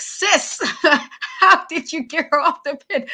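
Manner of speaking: high, loud incredulous sis with a laugh; mock outrage at the wrestler; exasperated disbelief. Fan scolding the wrestler for slipping the pin, incredulous. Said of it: "I was like".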